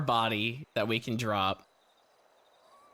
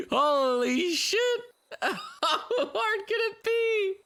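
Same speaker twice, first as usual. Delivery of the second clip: laughing disbelief; 3 dB louder, much slower than usual. Real, not acted.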